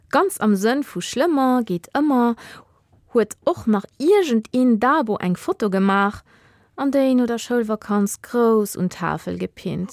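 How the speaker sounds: ordinary delivery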